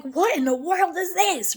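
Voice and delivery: high, exasperated mock outrage; irritated outburst. She retells her irritation at God's timing, mock exasperated. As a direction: throw up your hands, what is this. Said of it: "And I was so irritated at first".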